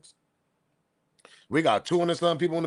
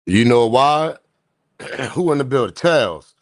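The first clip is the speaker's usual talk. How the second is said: loud; 3 semitones lower, 9 dB louder than usual. Real, not acted. Host calling out audience.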